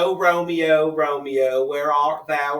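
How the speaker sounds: declaimed Romeo line in Appalachian accent; Appalachian-style Shakespeare. Shown as a demonstration. Proving to a doubter how Shakespeare sounded, Appalachian accent. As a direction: proclaim Shakespeare with a put-on Appalachian twang. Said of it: "let me hear an accent".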